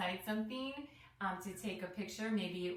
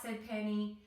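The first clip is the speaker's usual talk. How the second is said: usual speaking voice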